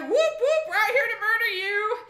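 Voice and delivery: high, loud whooping challenge; hammed-up bros yelling a threat; mock battle cry. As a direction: holler it like a war whoop. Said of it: "they're like".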